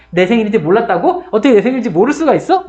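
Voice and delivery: 높고 빠르게 따지는 화난 목소리; angry outburst. Acted. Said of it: "어느 날 막 화를 내". (소심한 주인공이 화를 내며 따지듯이)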